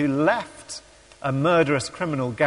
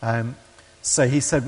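ordinary talk, nothing marked

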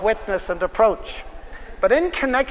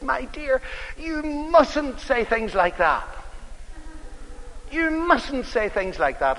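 higher and slow, scolding his wife; slow, pitched-up reprimand, a mild mock telling-off; sharp reprimand; 9 semitones higher, slower than usual. Husband scolding his wife at dinner, sharp slow reprimand. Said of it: "he reprimanded her rather sharply".

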